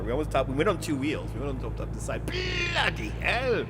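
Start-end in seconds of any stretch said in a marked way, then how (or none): 2.3-3.6 high, drawn-out exclamation; mock alarmed cry. Streamer who nearly toppled the truck, high drawn-out cry. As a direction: stretch it out high in mock shock.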